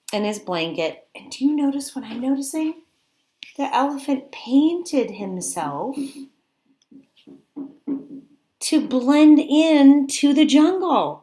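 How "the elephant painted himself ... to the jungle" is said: rising, louder and slow with wonder; delighted. Acted. Teacher reveals the painted elephant to children, louder and amazed. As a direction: savour the surprise.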